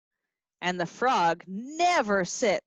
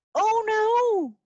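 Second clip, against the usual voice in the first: much higher, louder cry of alarm; mock alarm; 11 semitones higher, 4 dB louder than usual. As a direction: sound alarmed for the kids. Alarm in story.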